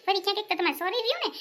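ordinary delivery

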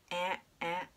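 "え" with an open English short-a sound, as in cat; between a and e. Shown as a demonstration. Teacher demonstrating phonics to learners, "え" as an open short-a sound. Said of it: "あとえと中間音です".

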